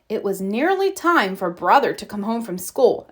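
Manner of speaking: plain reading voice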